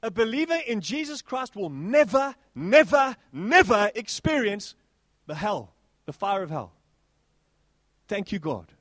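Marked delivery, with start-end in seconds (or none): 0.0-4.7 NEVER rising and louder each time; stressed never. Acted. Preacher insists believers never face hell, louder never.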